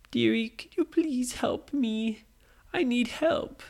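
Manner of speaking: put-on high, slow little old lady voice. Old lady begging Nora for help, high and frail. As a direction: quaver it up like a frail granny. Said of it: "the old lady said".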